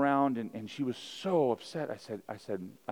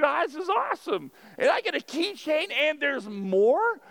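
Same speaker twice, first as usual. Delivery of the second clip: much higher, giddy shopper stretching out the last line slowly; mock thrilled viewer; 6 dB louder than usual. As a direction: gush like a bargain hunter. Mocking a TV commercial viewer.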